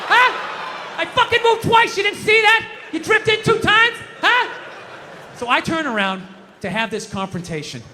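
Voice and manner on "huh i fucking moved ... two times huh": high-pitched, confrontational New York tough guy; indignant movie-tough-guy outburst; old-school Dustin Hoffman, De Niro. Acted. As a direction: yell it like an indignant pedestrian picking a fight. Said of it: "old school Dustin Hoffman, De Niro".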